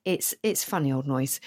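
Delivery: ordinary talk, nothing marked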